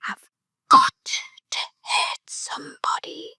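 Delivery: hot breathy whisper in the ear; imitating mum's whispered threat; menacing whisper. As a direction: whisper it close and chilling. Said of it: "cuddled me in, and she went".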